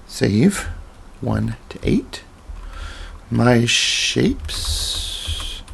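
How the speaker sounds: normal talking voice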